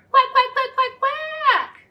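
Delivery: high playful quacks; duck quacking. Pretend. Duck sound for kids.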